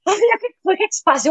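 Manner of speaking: mãe aflita em voz muito mais aguda, imitada de leve; imitando a mãe. Retold or imitated. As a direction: arremedo leve de mãe preocupada. A mãe consola a filha. (imitação leve da mãe, aguda e aflita)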